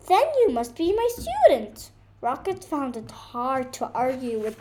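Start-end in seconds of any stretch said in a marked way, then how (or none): 0.1-1.9 bird voice cutting in, louder; interrupting bird. Acted. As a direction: cut in quickly as the little bird. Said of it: "the bird interrupted".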